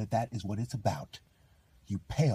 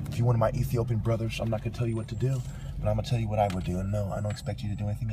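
rapid-fire; much faster than usual. Real, not acted. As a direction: rattle it off. Rapid address to brothers.

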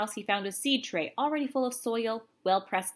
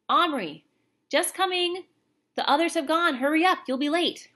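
calling out; 8 semitones higher, 6 dB louder than usual. Acted. Mother calls, son answers.

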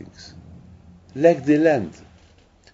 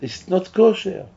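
high, louder plea; pleading eyes; 7 semitones higher, 3 dB louder than usual. Pretend. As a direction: beg high and urgent, refusing to look. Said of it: "And the eyes are screaming".

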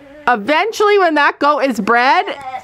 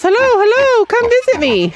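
high, chirpy voice for the excited pig; pig's voice; 11 semitones higher than usual. Pretend. Voicing the new pig greeting visitors, high and eager. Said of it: "He says".

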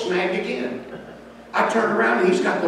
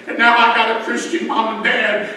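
building louder and higher to a full shout; preaching at full force, ever louder; preaching climax; 6 semitones higher, 8 dB louder than usual. Acted. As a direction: rise into the altar-call peak. Sermon climax, God on throne.

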